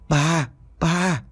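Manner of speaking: put-on high babbling baby voice, eerie; babbling ghost baby. A narrator's reading. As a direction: coo it like a creepy infant ghost. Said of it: "Giọng nói bập bẹ nhưng không kém phần ghê sợ".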